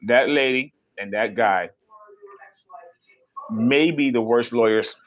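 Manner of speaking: ordinary delivery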